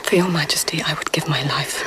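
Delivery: hushed devoted whisper; lady-in-waiting whispering to the Empress. Acted. Secret talk with the Empress.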